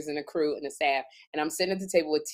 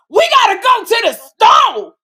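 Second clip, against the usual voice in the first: shouted, high, slow mock of Kim's loud line; loud sassy repeat; 14 semitones higher, 12 dB louder, slower than usual. Retelling how Kim repeated Tisha's line, hammed-up high shout. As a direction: belt it out, hammed-up ratchet sidekick. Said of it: "Kim would go".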